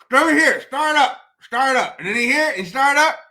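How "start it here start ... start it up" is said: loud, high imitation of a neighborhood mechanic; old mechanic. Old neighborhood mechanic calling to a car owner, loud gruff-man imitation. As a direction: call out like an old guy with a cigarette in his mouth. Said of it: "he talking to you like this".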